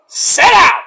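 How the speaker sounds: loud, high-pitched command; retold shout. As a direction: bellow it like you're breaking up a standoff. Retold referee ordering rivals apart.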